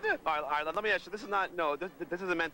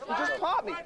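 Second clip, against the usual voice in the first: agitated high shouting; 9 semitones higher than usual. Real, not acted. Yelling he was just hit.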